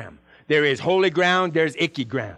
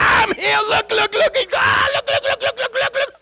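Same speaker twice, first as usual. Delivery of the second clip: much higher, yelling with joy; ecstatic shouting; 4 dB louder than usual. Acted. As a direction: shriek it out like a man just cured. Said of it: "he's going around going crazy".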